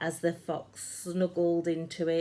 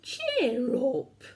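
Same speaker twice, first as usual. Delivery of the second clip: cheerful giant; 8 semitones higher, 4 dB louder than usual. A narrator's reading. George comforts the mouse.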